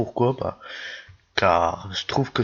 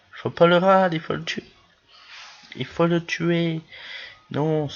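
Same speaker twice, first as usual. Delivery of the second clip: excited; 7 semitones higher than usual. Real, not acted. Urging on his ally in a fight.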